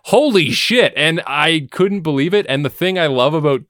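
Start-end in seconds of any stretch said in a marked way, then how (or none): 0.0-0.9 excited exclamation. Real, not acted. Amazed exclamation.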